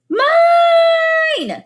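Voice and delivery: high, loud piercing screech; toddler screech. A narrator's reading. Boots screeching as Gregor mounts the bat, piercing screech. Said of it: "screeched Boots".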